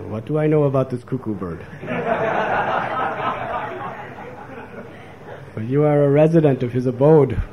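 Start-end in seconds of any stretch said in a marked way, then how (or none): 1.7-4.8 soft high laugh, drifting down; real laughter. Real, not acted. Preacher laughing at his own joke, soft high laugh. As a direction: let a gentle laugh spill out.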